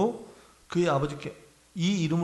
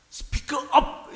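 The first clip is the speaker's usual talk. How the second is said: 힘찬 외침; 7 semitones higher than usual. Shown as a demonstration. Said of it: "이게 뜻이 무슨 뜻이냐면요".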